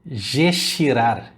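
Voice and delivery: "gechirar" said slowly, as a Spanish ear would hear it; slow, careful "gechirar"; mangled Spanish hearing. Shown as a demonstration. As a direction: draw out "gechirar" like a nonsense word. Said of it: "você vai ficar para ele".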